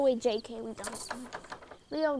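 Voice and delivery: ordinary delivery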